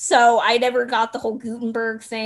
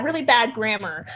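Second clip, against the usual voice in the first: ordinary talk, nothing marked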